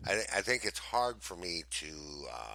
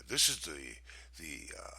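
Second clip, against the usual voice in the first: eccentric gentleman; 5 semitones lower, 3 dB quieter than usual. Shown as a demonstration. Co-host attempting a voice, hesitant eccentric gentleman. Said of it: "an eccentric, gentleman would speak to you".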